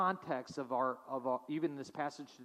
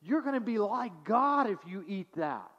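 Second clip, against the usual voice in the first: higher, louder coaxing tempter; Satan tempting; 5 semitones higher, 4 dB louder than usual. Retold or imitated. Satan tempting Adam and Eve, sly and coaxing. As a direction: coax like a sly serpent. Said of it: "Satan comes and says".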